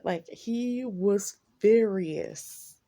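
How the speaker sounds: loud and drawn out; slow emphatic. Real, not acted. Telling viewers how angry he was, slow and heavy. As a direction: lean hard on furious. Describing his anger.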